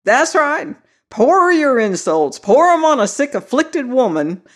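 put-on indignant old man. Old man takes offence, indignant.

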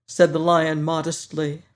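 ordinary delivery

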